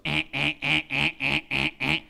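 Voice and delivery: loud, repeated jabbing "eh" noises; goading noises. Retold or imitated. Preacher imitating a manipulator prodding a crowd, loud needling noises. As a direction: prod them with short, loud, imitated grunts.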